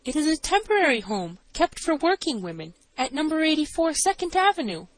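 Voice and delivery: high-pitched woman witness; nervous assistant matron. A narrator's reading.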